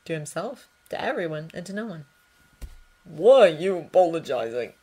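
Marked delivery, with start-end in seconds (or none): 3.0-4.7 louder, rough, raw voice; best friend asking roughly through sorrow. Acted. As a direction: ask it hoarse from grief. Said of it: "his voice rough and raw".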